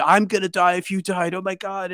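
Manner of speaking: high-pitched frantic wail, retold; imitated crying panic. Climbing partner wailing over the revived narrator, imitated crying panic. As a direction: play a distraught friend losing it. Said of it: "he's crying, and he's screaming".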